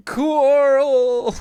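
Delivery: very high, loud mock cry; Rick yelling Coral. Streamer repeating the Rick meme, very high shout. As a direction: howl the name in hammed-up grief.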